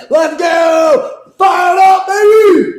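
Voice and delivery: high, loud rallying yell; hype shout. Real, not acted. Fan rallies his chat after big play, high loud hype. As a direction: fire up the crowd.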